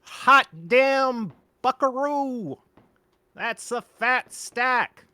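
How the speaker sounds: slow, high drawling impression; David Lynch. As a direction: stretch every word out slowly. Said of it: "David Lynch said fat stack".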